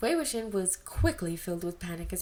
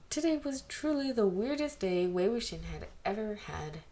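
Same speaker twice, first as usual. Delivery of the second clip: plain reading voice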